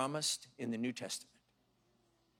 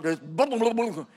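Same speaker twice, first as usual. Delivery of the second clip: repeated booms; 4 semitones higher, 6 dB louder than usual. Retold or imitated. Imitating repeated thuds.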